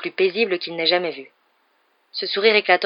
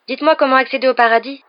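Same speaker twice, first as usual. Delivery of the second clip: put-on high, loud imploring child; sad pleading; 7 semitones higher, 7 dB louder than usual. A narrator's reading. Boy begs the man for the way to heaven, pleading. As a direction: beg for it, pitched well up. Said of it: "implora tristement Harry".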